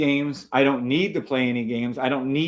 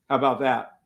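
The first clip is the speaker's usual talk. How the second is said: ordinary delivery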